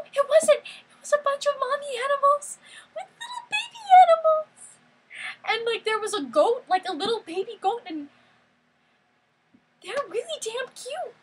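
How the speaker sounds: very high, squeaky gushing over babies; squealing delight. Real, not acted. Gushing over baby animals.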